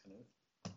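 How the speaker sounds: "Knuth" said kuh-NOOTH, K sounded before the N; hard-K pronunciation. Shown as a demonstration. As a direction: give "Knuth" two syllables, stress on the second. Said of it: "that's how I've been pronouncing it".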